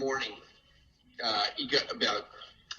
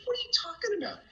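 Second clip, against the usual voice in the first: very high incredulous question; retold disbelief; faster than usual. Father hearing the prank about his son, incredulous. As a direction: imitate a stunned reply, pitched way up.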